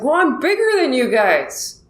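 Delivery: high-pitched mock yell; retold yell. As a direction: protest it as a re-enacted holler. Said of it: "I just yelled it".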